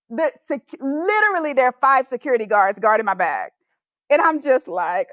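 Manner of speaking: usual speaking voice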